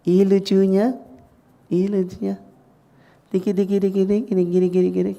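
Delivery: put-on high-pitched baby talk; playful high tickling chatter; gushing adult cooing. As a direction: imitate a parent fussing over a cute toddler. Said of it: "orang tua suka gini".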